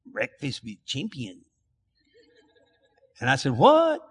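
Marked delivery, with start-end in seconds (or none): 0.0-1.4 put-on slow, soft Spanish-accented "Breakfast with Champions". Imitating Antonio saying "Breakfast with Champions" in a Spanish accent. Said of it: "I'll just do it in a Spanish accent". 3.5-4.0 high, surprised "What?"; puzzled what. Retold or imitated. Preacher reacting to Antonio's words, surprised.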